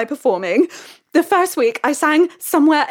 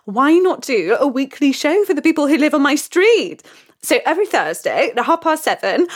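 ordinary talk, nothing marked

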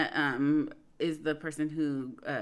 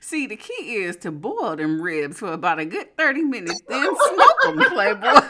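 pimp voice; 4 semitones higher, 9 dB louder than usual. Retold or imitated. Reading a tweet in character.

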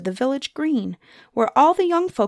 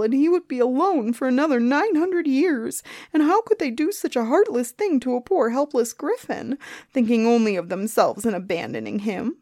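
grumbling complaint; 4 semitones higher than usual. A narrator's reading. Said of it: "the Griffin grumbled and mumbled".

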